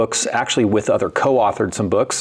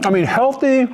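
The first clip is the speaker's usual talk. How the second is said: sarcastic; 12 semitones higher than usual. Real, not acted. Teasing wife about healthy claim.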